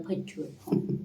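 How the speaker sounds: फचवून whispered softly and teasingly, as a repeat; soft teasing whisper of फचवून to the dog. Real, not acted. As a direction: breathe the word फचवून again as a soft tease.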